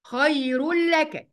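"خير لك" drawn out as khayrul-laka, no nun; idgham without ghunna. Shown as a demonstration. Teacher pointing out the rule, "خير لك" slowly merged without nasal hum. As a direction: chant "خير لك" slowly, tanween merged into a doubled lam. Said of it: "إدغام بدون غنة أهو".

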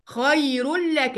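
"خير لك" drawn out as khayrul-laka, no nun; idgham without ghunna. Shown as a demonstration. Teacher pointing out the rule, "خير لك" slowly merged without nasal hum. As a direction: chant "خير لك" slowly, tanween merged into a doubled lam. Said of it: "آدي معايا".

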